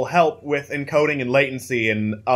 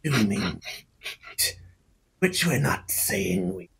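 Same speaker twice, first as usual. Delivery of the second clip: lizard folk voice; 4 dB quieter than usual. Acted. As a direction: voice a wary reptile, unhurried.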